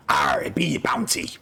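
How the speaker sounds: pirate voice. Retold or imitated. Pirate punchline of joke.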